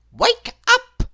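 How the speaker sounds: very high, loud wake-up call; Mister Silly crying out. A narrator's reading. Mister Silly waking his sleeping friend, loud high call. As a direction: shout him awake. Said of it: "cried Mister Silly".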